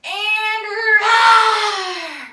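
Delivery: huge drawn-out roar; biggest lion roar. Shown as a demonstration. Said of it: "Biggest lion roar ever".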